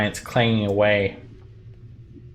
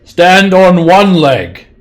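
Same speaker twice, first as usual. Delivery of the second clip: slow, loud, high giant command; dopey cartoon bellow; 9 semitones higher, 12 dB louder, much slower than usual. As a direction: boom out the order, slowly.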